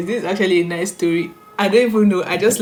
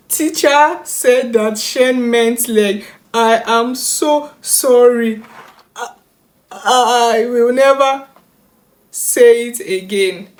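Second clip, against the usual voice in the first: put-on high, slow, sobbing child; mock sobbing child; 4 semitones higher, 5 dB louder, slower than usual. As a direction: wail it like a crying kid. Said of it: "reading this thing like this".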